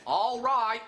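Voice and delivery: high-pitched, hammed-up Cockney; Cockney accent. Answering his boss, keeping up the practiced Cockney accent. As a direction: pipe it up in a mock Cockney voice. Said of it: "practicing my Cockney accent".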